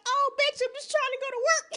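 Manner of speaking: high, quick voice for an indignant roach; put-out cockroach. Retold or imitated. Roach to the woman with the trash can, mock indignant. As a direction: imitate a bug with places to be. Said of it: "the roach is like".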